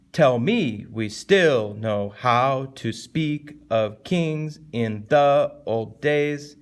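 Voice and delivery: very slow, heavily stressed verse; exaggerated rhythmic recitation. Shown as a demonstration. Teacher showing a class the poem's rhythm, slow exaggerated recitation. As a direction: draw out each beat of the line. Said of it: "Or, like".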